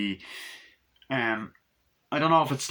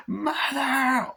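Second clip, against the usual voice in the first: frightened cry. Retold or imitated. Possessed girl cries for mother.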